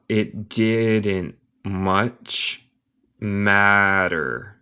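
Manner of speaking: very slow, careful model reading; slow teaching read. Shown as a demonstration. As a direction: spell it out word by word.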